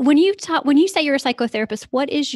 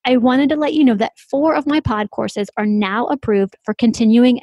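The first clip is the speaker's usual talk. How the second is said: bright, louder scripted host read; polished announcer voice, louder than the chat; podcast intro read; 6 dB louder than usual. Acted. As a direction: read the bio like a radio announcer. Host reads guest intro.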